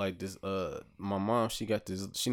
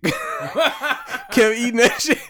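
words squeezed out high through laughter; laughing through it; 13 semitones higher, 8 dB louder, much slower than usual. Real, not acted. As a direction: laugh it out, slow and loud. Laughing at his own relapse.

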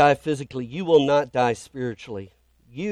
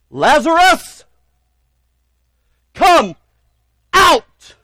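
huge commanding shout, much higher and louder; 14 dB louder, much slower than usual. Acted. Jesus shouting into the tomb for Lazarus, loud commanding shout. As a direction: call him out of the tomb with full force. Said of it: "he says with a loud voice".

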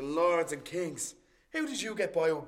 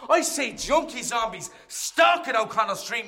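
ordinary talk

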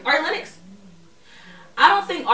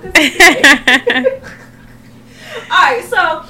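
laughing through the words; can barely talk for laughing; 6 semitones higher, 12 dB louder than usual. Real, not acted. Laughing while repeating.

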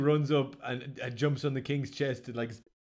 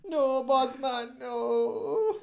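slow grieving wail; 10 semitones higher, much slower than usual. Acted. Character grief voice.

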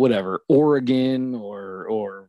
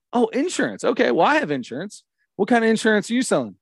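high-pitched mock interest; string the salesman along in a high voice; playing along; 8 semitones higher than usual. Man toying with an insurance telemarketer, playing along.

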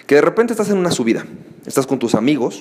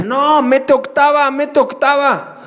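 higher, slow, drawn-out advice; smug passing driver; 10 semitones higher, slower than usual. Retold or imitated. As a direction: lean out the window and call it slowly. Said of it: "te dice".

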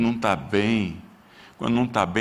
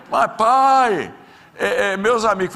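put-on high, louder pleading kid's voice; whiny teenage son; 11 semitones higher, 6 dB louder, slower than usual. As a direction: protest like a teenager who wants his friends. Son protesting to his father.

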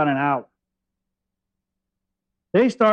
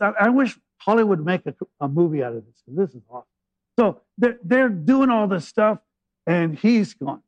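ordinary delivery

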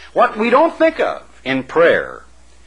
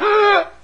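sharp high gasp of shock. Retold or imitated. Contestant hearing her name called, shocked gasp. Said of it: "strikes her like a shock. She goes".

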